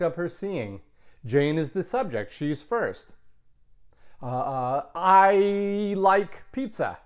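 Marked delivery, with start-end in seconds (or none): 4.9-7.0 said very slowly, each word apart, a little louder; slow model sentence. Shown as a demonstration. Teacher slowly models the sentence for the students. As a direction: spell it out slowly for the class.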